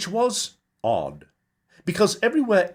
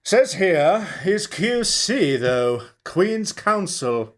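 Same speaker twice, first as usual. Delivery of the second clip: higher, slow pub regular voice; drawn-out remark from a pub regular; man in wool cap; 8 semitones higher, 3 dB louder, slower than usual. Acted.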